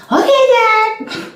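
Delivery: mock toddler answering her dad; little daughter voice. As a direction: squeak it like an obedient toddler. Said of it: "And she'd be like".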